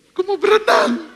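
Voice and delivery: put-on high little child's voice, loud; squeaky kid asking to swim. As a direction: mimic a small kid begging. Child voice in sermon anecdote.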